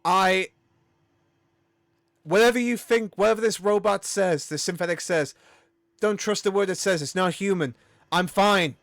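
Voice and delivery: loud, high-pitched defensive protest; freaking out. Acted. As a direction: insist you're fine while starting to freak out. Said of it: "kind of freaking out a bit".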